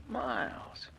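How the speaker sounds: a breathy conspiratorial whisper. Acted. Plotting killer whispering to his lover. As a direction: hush it, savouring the isolation.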